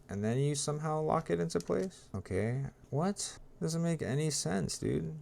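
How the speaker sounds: high, soft, incredulous squeak; exasperated disbelief. Real, not acted. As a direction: pitch up softly in baffled disbelief. Baffled builder muttering in disbelief.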